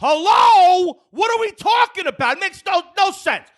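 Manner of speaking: incredulous yelling at the clerk; exasperated outburst. Retold or imitated. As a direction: blow up at the absurd rule. Retold outburst at the desk.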